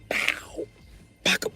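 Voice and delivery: ordinary talk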